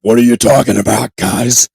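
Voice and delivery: loud, booming confrontational voice; mock tough guy. Pretending he strode out to challenge the guys, booming tough voice. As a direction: boom it out like a hulking brute. Imagined tough walk out of stall.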